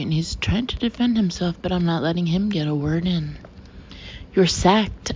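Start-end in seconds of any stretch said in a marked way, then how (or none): 4.3-5.1 a mild mock snap, a touch louder; scathing snap. Lexi on TV firing the guy with a scathing snap. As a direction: bite the words off in contempt. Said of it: "I snap at last".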